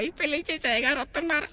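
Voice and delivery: put-on high, excited village boy calling out; squeaky kid announcing the arrival; child's voice. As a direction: shout the news like a small boy.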